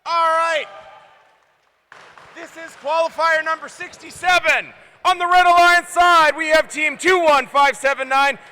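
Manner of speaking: loud arena announcer intro; loud announcer introducing alliance teams; match announcer. Acted. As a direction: boom the team names to the crowd.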